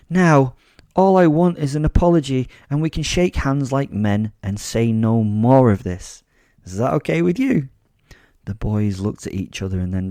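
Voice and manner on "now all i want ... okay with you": raised, theatrical schoolmaster-like demand; commanding lecture. Acted. Attacker demanding apology from two boys, commanding. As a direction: lay down terms firmly, lifting the final question.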